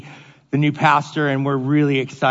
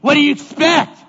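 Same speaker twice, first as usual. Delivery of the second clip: high, loud dismissive bro voice; careless dog owner; 11 semitones higher, 5 dB louder than usual. Retold or imitated. Dog owner brushing off the bitten pastor, loud and dismissive. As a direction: shrug it off like it's obvious. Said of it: "he's like".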